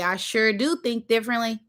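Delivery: normal talking voice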